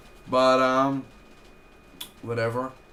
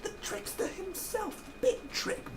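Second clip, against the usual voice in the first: much higher, thin put-on villain voice; Trickster voice; 5 dB quieter than usual. Reviewer naming the villain in a high Trickster voice. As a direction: announce it like a gleeful trickster. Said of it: "trying to do the trickster voice".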